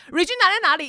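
high, fast, pestering grandma voice; imitating an anxious grandma pressing for an answer; nagging grandma. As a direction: rattle it off like a worried grandma who won't stop asking. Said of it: "阿嬷就是不停的".

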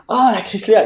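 high-pitched "oh!" of recognition; sudden realisation. Retold or imitated. Boss finally understanding the newcomer, sudden realisation. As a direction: imitate someone who has finally caught on.

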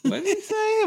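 very high incredulous question; mock aghast old man; shocked neighbour. Neighbour shocked by the toddler's word, high incredulous.

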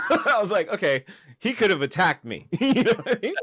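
high laughing retelling; can't stop laughing while telling it; laughing through words. Real, not acted. Laughing retelling coyote scare.

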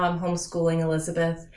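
usual speaking voice

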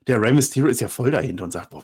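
normal talking voice

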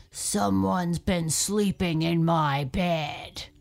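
loud, slow bear grumble; Daddy Bear grumbling about his bed. Acted. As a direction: grumble it like a big cross bear. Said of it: "he grumbled".

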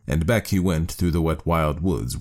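ordinary delivery

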